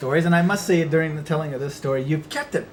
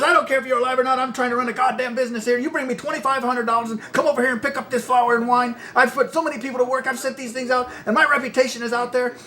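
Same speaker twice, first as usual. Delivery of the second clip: irate producer; 8 semitones higher than usual. Retold or imitated. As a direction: rattle off the complaints like an irate boss.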